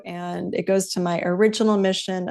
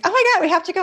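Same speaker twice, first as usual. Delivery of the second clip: put-on higher, eager teen squeal, a bit louder; excited teen; 11 semitones higher, 4 dB louder, much faster than usual. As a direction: gush like a thrilled daughter. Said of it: "She's like".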